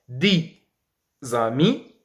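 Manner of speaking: "dix amis" with x as z opening "amis"; liaison. Shown as a demonstration. Teacher demonstrating liaison, "dix amis" separated as di, za-mi. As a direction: carry a z sound from "dix" onto "amis". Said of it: "On appelle cette règle la liaison".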